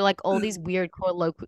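normal talking voice

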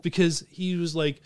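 normal talking voice

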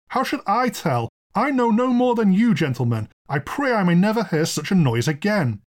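high-pitched, distressed plea; protesting landlord. Acted. Frightened landlord protests his ignorance.

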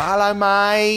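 high, loud Cockney geezer hello; Cockney geezer impression. Host imitating film geezer, camp Cockney hello. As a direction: do the Shaun of the Dead geezer. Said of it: "is that geezer in Shaun of the Dead, you know, when he's like".

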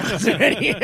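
loud mocking repeat through laughter; mock whine cracking into laughter; laughing mock whine. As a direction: laugh while mimicking his whine. Repeating opponent's protest laughing.

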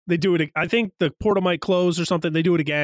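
ordinary talk, nothing marked

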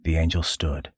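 ordinary delivery, nothing marked

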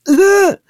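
a sharp, high, loud "ugh" of disgust; exasperated groan. Pretend. Kara facepalming at the villains: high, loud exasperated groan. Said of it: "does a face palm and goes".

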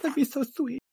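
put-on very high, quick girly gush; high-pitched sugary enthusiasm; gushing valley girl, sweet. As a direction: coo in a high girlish voice.

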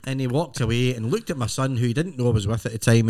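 normal talking voice

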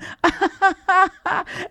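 high-pitched phony laugh; hammed-up Stepford wife laugh, high and forced; fake manic laugh. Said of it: "huge fake smile".

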